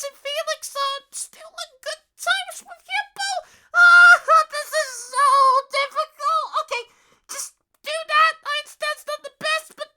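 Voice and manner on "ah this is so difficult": loud exasperated cry; frustrated outburst. Real, not acted. Player overwhelmed by the situation, loud frustration. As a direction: vent the stress.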